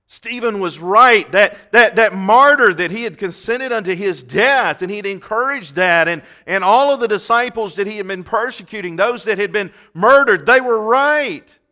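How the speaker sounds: the preacher drives home that the martyrs were right; preaching at full force. Real, not acted. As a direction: build the point to a ringing climax. Sermon climax.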